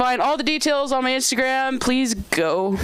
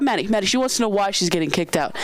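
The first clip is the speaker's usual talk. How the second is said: ordinary talk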